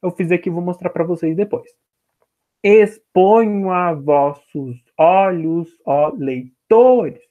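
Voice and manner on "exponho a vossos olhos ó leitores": very slow, measured syllable-by-syllable reading; scanning the verse. Shown as a demonstration. Teacher counting ten syllables in a verse, very slow measured scansion. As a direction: step through each poetic syllable, holding the last stressed one. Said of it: "é conforme eu vou falando".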